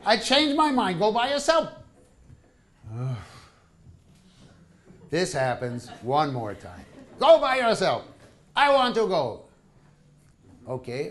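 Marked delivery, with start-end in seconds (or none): 0.1-1.7 wife refusing. Retold or imitated. 7.2-9.4 wife flip-flopping. Retold or imitated. Wife flips again.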